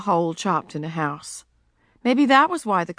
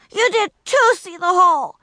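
put-on high, loud shouting child; 11 semitones higher, 5 dB louder than usual. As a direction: yell the accusation. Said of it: "she shouted".

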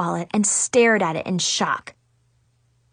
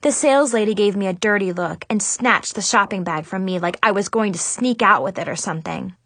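plain reading voice

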